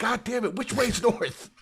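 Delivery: high-pitched comic frustration; hammed-up outburst, laughing through it; mock exasperation. Retelling a lost character at a dead end, mock exasperated.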